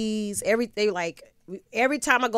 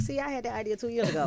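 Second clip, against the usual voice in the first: told-you-so; 4 semitones higher, 4 dB quieter than usual. Retold or imitated. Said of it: "I was like".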